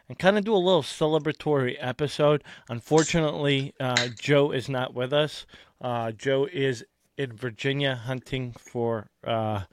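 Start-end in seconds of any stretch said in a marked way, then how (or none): none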